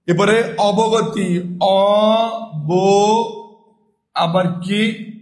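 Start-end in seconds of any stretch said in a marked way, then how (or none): 1.6-3.5 অব- drawn out as "aw-bo"; syllable-by-syllable pronunciation. Shown as a demonstration. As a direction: sound out অব- slowly, open "aw", then rounded "bo". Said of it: "কি হয়ে উচ্চারণ হলো".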